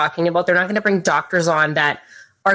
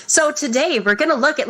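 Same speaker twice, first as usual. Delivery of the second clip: higher-pitched mock news anchor; 9 semitones higher than usual. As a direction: imitate a robotic TV presenter's intro. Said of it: "they all talk like this in the same voice".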